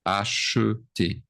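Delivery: slow Acheter, split syllable by syllable; clear pronunciation demo. Shown as a demonstration. Teacher showing what matters for pronunciation, slowly sounded-out Acheter. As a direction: draw out Acheter as a-che-té, muted middle e. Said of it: "important for pronunciation".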